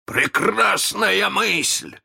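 high, drawn-out delight; overjoyed villain exclaiming. A narrator's reading. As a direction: relish the idea with slow, rising glee. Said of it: "обрадовался Крюк".

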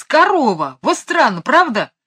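high, loud exclamation of wonder; exclaim, then eagerly seek agreement; excited marvelling. A narrator's reading. Michael showing Mary Poppins the cow, excited.

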